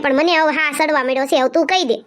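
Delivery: usual speaking voice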